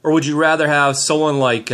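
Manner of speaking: ordinary talk, nothing marked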